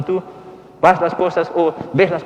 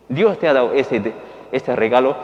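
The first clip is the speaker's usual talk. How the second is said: normal talking voice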